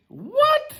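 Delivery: high squeak of disbelief; mock surprise. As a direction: act amazed at the offer. Incredulous aside.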